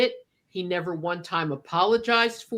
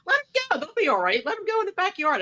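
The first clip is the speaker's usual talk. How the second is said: higher mock husband voice, lightly done; husband pleading to let the wrestlers smoke, in a higher mock voice; imitating Paul; 10 semitones higher, faster than usual. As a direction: airily brush off the objection. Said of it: "I'm like".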